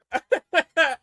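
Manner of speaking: loud, high cackling laugh; sudden high whooping laugh; burst of laughter. Real, not acted. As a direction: crack up loudly. Reacting to the Liv joke.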